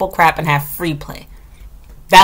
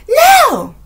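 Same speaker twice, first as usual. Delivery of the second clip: very high imitated kid shriek; mock child scream; 3 dB louder than usual. Boy refusing when teacher calls him back, mock scream. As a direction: screech it like an angry little boy. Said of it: "Like, screaming".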